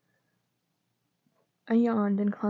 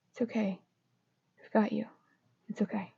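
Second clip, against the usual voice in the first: quiet, tender comfort; soft reassurance; 6 dB quieter, faster than usual. A narrator's reading. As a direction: murmur it like holding someone. Holding him as he cries.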